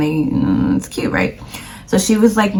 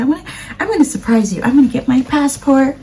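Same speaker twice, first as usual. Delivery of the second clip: high, quick imitation of mom; 7 semitones higher, faster than usual. Daughter imitating her mom's eager surprise promise, high. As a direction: rush it out, pitched up and eager.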